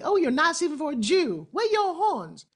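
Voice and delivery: high-pitched imitation of silly questioners; mock naive questioner. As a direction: play a clueless local, pitched well up.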